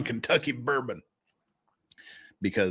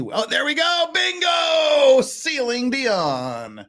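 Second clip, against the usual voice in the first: high triumphant shout of Bingo; excited bingo shout; 13 semitones higher, 3 dB louder, much slower than usual. Real, not acted. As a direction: yell out the win, stretching Ceiling Dion.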